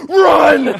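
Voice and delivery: loud high shout, all out; panicked shout to his friends as the fence falls; yelling run. Retold or imitated. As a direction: yell it so everyone bolts at once. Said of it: "I just go".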